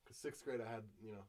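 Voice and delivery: ordinary talk, nothing marked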